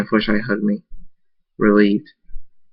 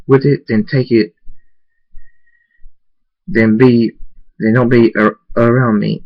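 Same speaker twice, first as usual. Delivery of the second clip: louder, heated defiance; louder and louder at the princess; defiant outburst; 3 dB louder than usual. Acted. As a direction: push back at her hard. Defiant rant at Celestia.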